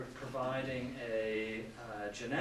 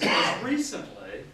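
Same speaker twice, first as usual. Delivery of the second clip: "recently" punched louder and higher; stress on "recently"; 6 semitones higher, 9 dB louder than usual. Real, not acted. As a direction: hit "recently" hard, with raised pitch and volume. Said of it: "I stress the word recently".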